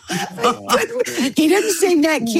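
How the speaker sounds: giggling as she tells it; laughing. Real, not acted. Host laughing to the interviewer about the guard's answer, giggling.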